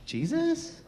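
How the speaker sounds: high, soft puzzled rise; surprised question. Acted. Believer seeing Jesus as a woman, puzzled. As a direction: ask in disbelief.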